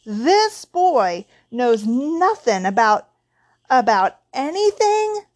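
slow, loud, climbing to a high finish; indignant, heavily stressed words; growled outrage. A narrator's reading. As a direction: build to an incredulous peak. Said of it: "he growled at the Dursleys".

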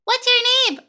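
put-on very high, loud, sing-song little girl; chirpy kid voice. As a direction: pipe it up like an eager child. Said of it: "she's like".

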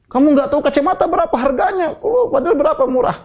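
high-pitched mock scolding over cheap glasses; scolding stingy parent. Preacher mimics a stingy parent scolding the child, high mock scolding. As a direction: play the petty parent, shrill and half laughing.

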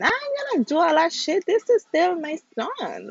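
defiant outburst. Real, not acted. Defiant outburst about her son.

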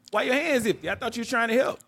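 retold high-pitched accusation; accusing. Snapping at dad in retold scene.

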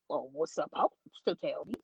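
low, soft questioning echo; retold disbelieving echo. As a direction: echo it back low and doubtful. Echoes bottle girl back in disbelief.

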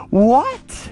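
high, loud disbelieving yelp; stunned that dad fixed it so fast, a high startled what. Retold or imitated. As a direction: squeak it out in total disbelief. Shocked the fix was instant.

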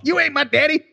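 lightly acted kid disowning dad; mock teenage backtalk; defiant son. Acted son retorting to father.

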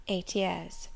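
understated governess's reply; meek governess. A narrator's reading. Governess answers the master's question, mildly subdued. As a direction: answer simply, lightly subdued.

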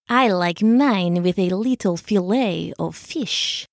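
low, purring cat voice. A narrator's reading. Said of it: "she purred".

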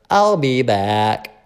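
slow Terminator-style robotic voice; deadpan movie villain line; funny voice. Retold or imitated. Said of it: "did that funny voice".

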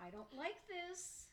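mock nervous inner voice, pitched high; retold nervousness. Guest retelling her thoughts while dancing with a stranger, mock nervous. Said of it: "I was getting really nervous".

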